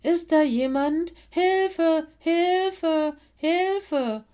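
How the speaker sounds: loud, high cries for rescue; repeated high-pitched shouts; calling for help. A narrator's reading. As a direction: call out urgently to anyone who might hear. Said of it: "rief Willis".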